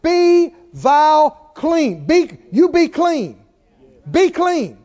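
loud, high, long-held BE; drawn-out preacher's decree; commanding. Retold or imitated. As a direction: proclaim it slowly and forcefully. Quoting Jesus' healing command.

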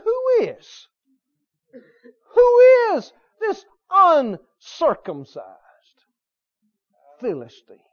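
high, loud scornful cry falling away on the last word; derision. Acted. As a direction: hurl it out slowly with open contempt. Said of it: "I believe he said it with derision".

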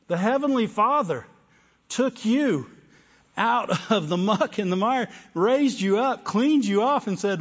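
loud, high fervent preaching; rising, fiery sermon voice; preaching at full force. Acted. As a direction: lift it up and preach it out. Preaching climax.

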